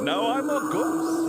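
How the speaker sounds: ghost voice. Pretend. Ghost voice joke.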